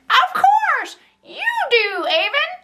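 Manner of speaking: put-on very high old-timer voice; amused old man. A narrator's reading. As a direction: exclaim high and drawn out, tickled by the child. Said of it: "He laughed".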